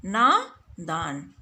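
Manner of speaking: नादान with a long ना, very slow; correct long aa. Shown as a demonstration. Teacher giving pupils the correct word, very slow. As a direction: draw out ना in नादान. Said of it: "लेकिन शुद्ध शब्द क्या होगा".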